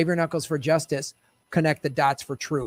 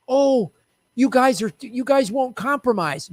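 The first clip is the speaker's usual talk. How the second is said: higher, sneering mock of politicians; mocking condescending voice; 6 semitones higher than usual. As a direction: parrot them with a sneer.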